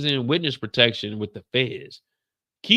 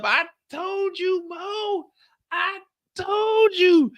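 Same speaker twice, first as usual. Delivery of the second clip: much higher, slow singsong told-you-so; Kendall teasing; much slower than usual. Retold or imitated. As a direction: gloat like a know-it-all partner. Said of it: "Kendall hitting me up".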